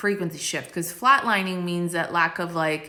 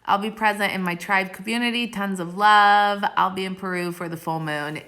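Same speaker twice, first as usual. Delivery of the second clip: ordinary talk, nothing marked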